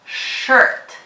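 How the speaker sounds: shirt opening on a long hushed sh; showing the sh in shirt. Shown as a demonstration. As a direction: lean on the sh at the start of shirt. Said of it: "Do you hear the S H digraph".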